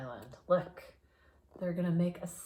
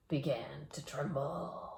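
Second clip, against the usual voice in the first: hushed and ominous; suspenseful whisper; 4 semitones lower, 7 dB quieter than usual. A narrator's reading. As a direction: whisper it, building dread. Suspense as island trembles.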